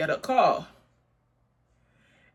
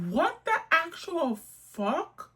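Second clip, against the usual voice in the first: higher and slow, drawn out; incredulous; 9 semitones higher, slower than usual. Retold or imitated. Replying to the caller, slow high disbelief. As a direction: spell out the disbelief.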